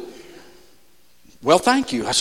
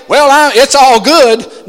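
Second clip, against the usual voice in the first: mock breezy denial; 6 semitones higher, 8 dB louder, slower than usual. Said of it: "don't go up and say".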